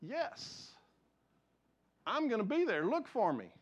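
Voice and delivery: sure, joyful assurance; confident answer. Shown as a demonstration. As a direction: say it like you already know you're going. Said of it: "Our answer should be".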